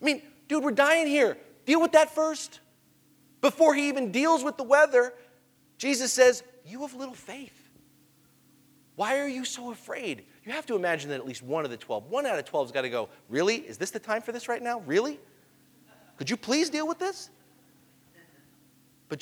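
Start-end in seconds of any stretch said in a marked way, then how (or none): 0.5-2.6 high, urgent protest; mildly frantic complaint to the one in charge; exasperated plea. Acted. Voicing exasperated drowning disciple. 6.6-10.3 very soft, higher-pitched voice; hushed, unhurried questioning; calm gentle reproof. Acted. Jesus calmly answering panicked disciples. 13.3-17.3 restrained exasperated questioning, rising at the end; soft-voiced disbelief turning to pleading; incredulous. Disciple objecting to ill-timed question.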